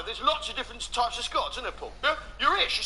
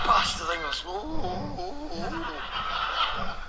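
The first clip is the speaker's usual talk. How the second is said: pleasured Scotsman; much slower than usual. Acted. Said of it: "sounds like he's having his genitals rubbed".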